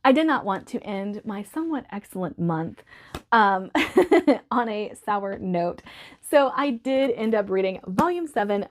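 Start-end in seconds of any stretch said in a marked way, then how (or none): 3.8-4.5 laugh. Real, not acted. Laugh mid-sentence.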